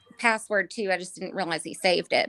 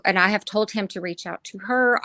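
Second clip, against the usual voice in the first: ordinary talk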